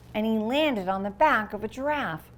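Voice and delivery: ordinary delivery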